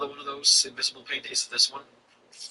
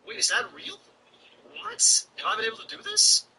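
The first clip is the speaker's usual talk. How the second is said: sudden high, rising disbelief; is that real, pitched way up in shock; surprised disbelief. Real, not acted. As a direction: caught off guard, voice jumps up high. Streamer finds unexpected game feature.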